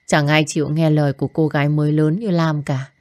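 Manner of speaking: ordinary delivery, nothing marked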